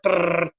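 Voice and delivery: rapid rolled brrr; Gatling gun noise. Shown as a demonstration. Stuntman likening his speech to a Gatling gun, imitated rattle. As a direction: imitate a burst of gunfire. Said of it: "I'm like a Gatling gun".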